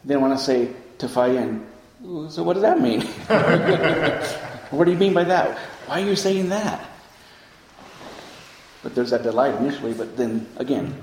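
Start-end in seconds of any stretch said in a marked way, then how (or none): 2.3-6.8 puzzled questioning. Retold or imitated. Own mind questioning the teacher.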